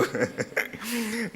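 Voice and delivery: high breathy burst of laughter. Real, not acted. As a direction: break up laughing mid-story. Speaker laughs mid quote.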